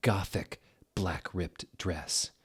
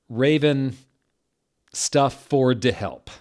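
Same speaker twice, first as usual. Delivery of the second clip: higher, louder, hammed-up angry rant; mock-furious author; 6 semitones higher, 10 dB louder, slower than usual. The teen author yelling at her critics, in a hammed-up furious voice.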